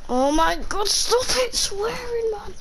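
tearful protest climbing high; exasperated, nearly crying complaint; upset pleading. Real, not acted. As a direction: plead with family, pitch rising sharply. Pleading with family during recording.

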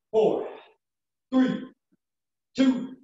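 high rising countdown; countdown call. Real, not acted. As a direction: count them down to push. Counting down reps.

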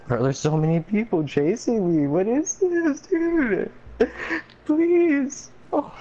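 high, desperate begging; panicked pleading. Real, not acted. Swarmed by players.

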